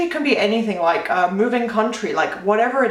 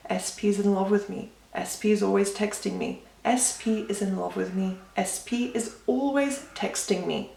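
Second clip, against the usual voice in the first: soft, sleepy affirmation murmur; calm, relaxed; 7 dB quieter than usual. Shown as a demonstration. Coach demonstrating bedtime affirmations to repeat while falling asleep, calm and relaxed. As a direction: repeat it softly, as if lulling yourself to sleep. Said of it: "try and say it in a more relaxed way".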